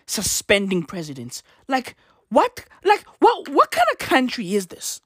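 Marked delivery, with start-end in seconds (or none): 1.7-5.0 high, incredulous "what"; flabbergasted; disbelief. Real, not acted. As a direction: pitch up in exasperated disbelief. Exasperated outburst at politics.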